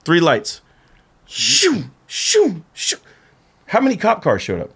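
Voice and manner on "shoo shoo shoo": high, falling whooshes, one after another; repeated airy zoom noise; imitated whoosh. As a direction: make cars zooming in with your mouth. Imitates cop cars whooshing up.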